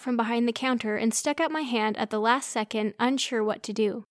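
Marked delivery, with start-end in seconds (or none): none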